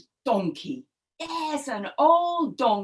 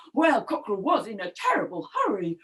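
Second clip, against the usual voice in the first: usual speaking voice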